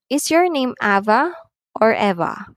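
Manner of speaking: "Ava" rising, "Eva" falling; rise-fall choice question. Shown as a demonstration. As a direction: lift "Ava", drop on "Eva". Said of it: "the first word or choice is being risen up".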